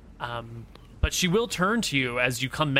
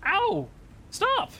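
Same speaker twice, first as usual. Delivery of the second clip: much higher squeaky ow and stop; high mock cry of pain, lightly played; small woman's cry of pain. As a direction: voice her as tiny and hurt, pleading. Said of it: "she will cry out a little bit".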